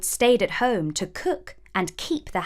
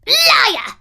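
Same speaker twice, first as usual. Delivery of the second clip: high, loud furious screech; the queen shrieking at her mirror; 8 dB louder than usual. A narrator's reading. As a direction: scream it in rage. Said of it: "screeched the queen in her rage".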